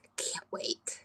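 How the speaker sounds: breathless whisper of excitement; excited whisper. Real, not acted. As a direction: whisper it, thrilled. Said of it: "I'm so excited and can't wait".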